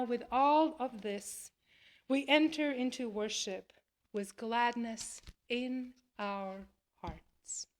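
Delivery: ordinary talk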